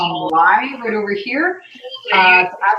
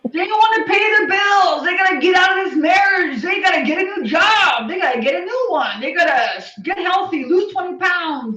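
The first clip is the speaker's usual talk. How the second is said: fired-up rant; 8 semitones higher than usual. Real, not acted. Passionate rant about client needs.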